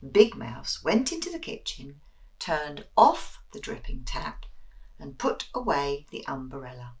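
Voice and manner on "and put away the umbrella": usual speaking voice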